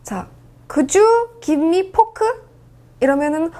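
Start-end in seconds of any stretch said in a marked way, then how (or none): 0.7-2.3 slow, higher-pitched restaurant request; Koreanized English. Shown as a demonstration. Learner asking for a fork at a restaurant, Koreanized English. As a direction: stretch out "fork" in a careful request. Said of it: "포크, 여러분들 포크 달라는구나".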